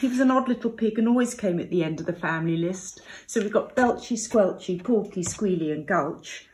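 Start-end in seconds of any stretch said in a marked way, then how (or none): none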